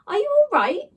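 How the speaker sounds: high, anxious call; worried call. Acted. Boy checking on the flattened slime, worried. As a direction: call out in worry.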